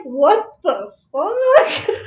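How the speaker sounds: slow, drawn-out laughing what the fuck; laughing disbelief. Retold or imitated. Imitating the birthday girl's stunned reaction, laughing slowly. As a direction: stretch it out through laughter. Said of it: "she's like".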